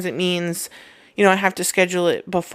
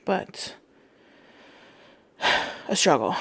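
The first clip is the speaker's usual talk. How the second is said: breathless and sighing; winded, short on air; out of breath; 6 dB quieter than usual. Real, not acted. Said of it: "I'm out of breath".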